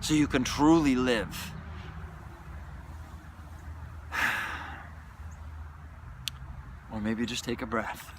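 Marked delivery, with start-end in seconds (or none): 1.6-6.9 long breathy exhale; deep release sigh. Real, not acted. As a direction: let everything go in one sigh. Release after shouting.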